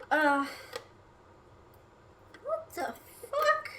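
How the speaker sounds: high, slow, stunned what the fuck; drawn out in real disbelief; shocked pitch jump; baffled. Real, not acted. Confused by wrong call.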